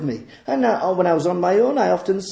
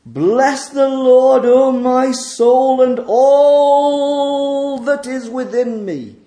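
slow proclaimed psalm; 3 semitones higher, 4 dB louder, slower than usual. Acted. Reading the psalm as praise.

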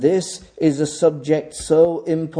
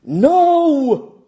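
sharp high NO, louder; emphatic no; 7 semitones higher, 4 dB louder than usual. Retold or imitated. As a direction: cut him off hard. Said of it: "emphatically translates it like this".

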